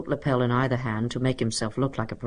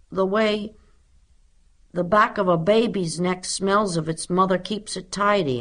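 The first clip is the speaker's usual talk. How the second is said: higher voice, halting between phrases; thoughtful schoolboy; 5 semitones higher than usual. A narrator's reading. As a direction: pause and search for each image. Voices pupil Henry's thoughtful answer.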